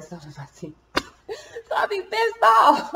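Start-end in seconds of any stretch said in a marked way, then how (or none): 0.9-1.6 sudden high giggle; amused laugh; high burst of laughter. Real, not acted. Laughing at own teeth. 1.7-2.9 slow, high and loud through laughter; giggling mock annoyance; laughing. Real, not acted. Laughing about her teeth.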